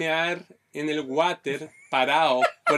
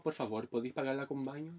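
much softer voice for her request; soft pleading request; 2 semitones lower, 11 dB quieter than usual. Retold or imitated. Woman asking him to pay for the bathroom, soft request. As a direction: put the quoted request gently and quietly.

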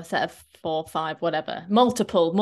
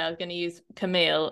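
ordinary talk

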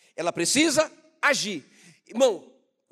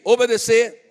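loud, high call for the crowd to echo; preacher's rallying shout; 5 semitones higher, 12 dB louder than usual. Shown as a demonstration. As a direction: shout the key word out to the congregation. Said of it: "Grita aí".

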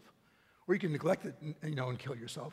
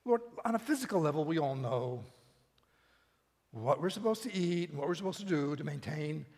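quick earnest prayer opening. Acted. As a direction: address the Lord reverently. Opens prayer.

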